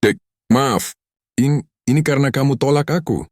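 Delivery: high, loud frightened apology; panicked plea. A narrator's reading. Husband after hurting wife, panicked. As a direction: blurt the excuse in panic.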